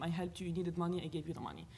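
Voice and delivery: usual speaking voice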